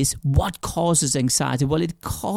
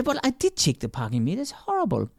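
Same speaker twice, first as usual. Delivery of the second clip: high, softer, exasperated complaint; frustrated; 6 semitones higher, 4 dB quieter than usual. Retold or imitated. Respondent complaining about the ticket, high and frustrated. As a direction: whine that it's unfair, pitched up. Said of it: "They would be really frustrated about this".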